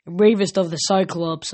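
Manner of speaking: plain reading voice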